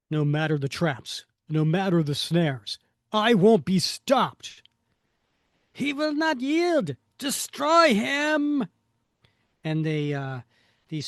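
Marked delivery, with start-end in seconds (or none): 0.1-4.6 louder comic-book hero declaration; determined hero, hammed up; defiant hero. Reads hero's defiant comic dialogue. 5.7-8.7 high, loud hammed-up villain shout; comic-book villain calling for destruction; villainous command. Reads villains' shouted comic dialogue.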